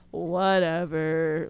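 drawn-out, very slow dismissive whatever. Real, not acted. Annoyed he never called, slow dismissive drawl. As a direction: brush it off, stretching it slowly.

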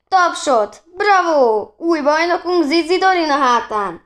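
high, loud mock-triumphant announcement; cheering announcer. A narrator's reading. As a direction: hail the champion like a ringside announcer. Boys mockingly announce new champion.

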